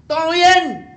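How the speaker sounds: high, loud shout across water; comic calling out. Acted. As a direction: call out to a distant boat. Jesus voiced calling to boat.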